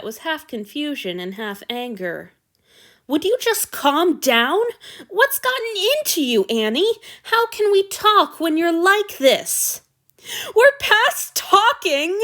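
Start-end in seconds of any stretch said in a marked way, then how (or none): 3.1-12.2 raised, heated plea; angry shouting. Acted. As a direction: shout it at him, fed up. Lovers' fight, shouted dialogue.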